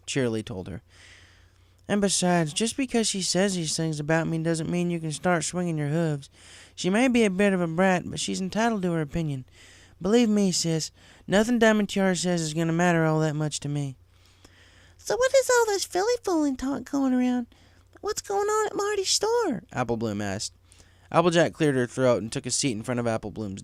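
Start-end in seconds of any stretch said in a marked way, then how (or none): 1.9-14.0 lightly raised, earnest farm mare confiding; a friend admitting how much she cares; Applejack's voice. Acted. Applejack confiding in Twilight, lightly voiced earnest. 15.0-19.6 put-on much higher curious child voice; a kid asking what it all means; young filly Apple Bloom. Apple Bloom asking her sister about filly fooling, high child voice.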